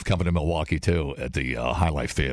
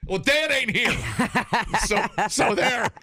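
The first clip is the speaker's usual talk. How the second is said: high defiant laughing retort; childish high-pitched comeback; defiant mock whine; 13 semitones higher than usual.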